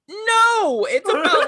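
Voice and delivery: high, loud imitated protest; emphatic mock denial. Coworker correcting a daddy-issues guess, emphatic mock denial.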